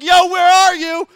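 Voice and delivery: loud, high, flippant yell; high mocking shout of impatience; mock impatient shout. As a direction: shout it like a careless kid calling out. Israelites mocked as impatient.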